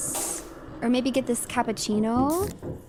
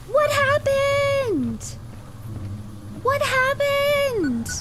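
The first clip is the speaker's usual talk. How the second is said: stretched falsetto daze; much slower than usual. Pretend. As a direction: stretch it out in a silly high voice. Repeats the dazed question.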